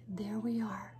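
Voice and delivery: whisper. Real, not acted.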